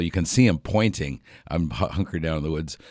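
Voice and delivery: normal talking voice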